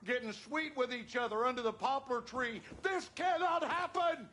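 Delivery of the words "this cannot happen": furious raised yell; angry shout. Acted. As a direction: explode at your wife. Father's angry outburst.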